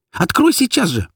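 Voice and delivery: high, urgent shouted order; frantic cry to be let out; angry yell. A narrator's reading. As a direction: demand it at a high pitch. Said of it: "закричала я, дёргая ручку двери".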